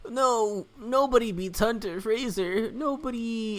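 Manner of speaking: cocky rival boast, stressing nobody; a hint of a smug rival; voicing Hunter. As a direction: puff up like a smug champion.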